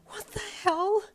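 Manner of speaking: high loud shocked gasp; aghast outburst; shocked girl gasping. A narrator's reading. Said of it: "you gasped internally".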